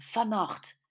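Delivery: ordinary delivery, nothing marked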